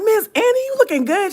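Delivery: high, loud gushing compliment; excited compliment. Retold or imitated. Complimenting a coworker's new braids, high and loud gushing. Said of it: "And so I'm just like".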